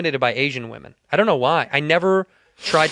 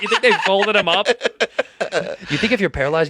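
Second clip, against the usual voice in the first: laughing; 6 semitones higher, slower than usual. Real, not acted. Laughing while talking.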